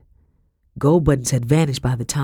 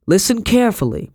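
higher, urgent cut-in; interrupting; 6 semitones higher, 3 dB louder than usual. A narrator's reading. Master cutting off his wife's questions, urgent interruption. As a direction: break in with an urgent order. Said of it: "Lockton interrupted".

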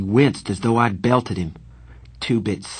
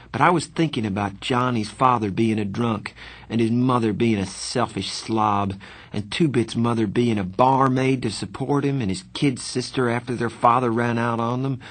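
usual speaking voice

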